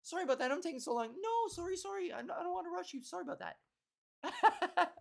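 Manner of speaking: high-pitched, hammed-up polite back-and-forth; mock Canadian apologizing. Two Canadians out-apologizing each other in a line, mock apologetic. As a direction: trade apologies in a lifted, laughing voice. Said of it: "good old-fashioned Canadian sorry hootenanny".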